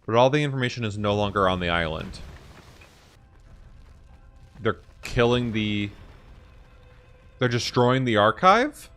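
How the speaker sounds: usual speaking voice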